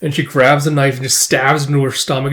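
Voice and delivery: normal talking voice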